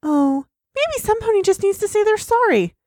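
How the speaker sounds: acted Twilight Sparkle. Acts Twilight reading from her book.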